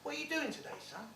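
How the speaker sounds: a dad asking offhand; dad's voice. Retold or imitated. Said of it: "he said".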